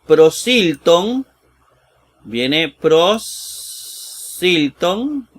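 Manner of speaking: prosilton stretched slowly, syllable by syllable; slow Greek reading. Shown as a demonstration. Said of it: "reads Greek word aloud".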